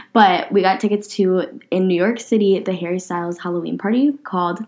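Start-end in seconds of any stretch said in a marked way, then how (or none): none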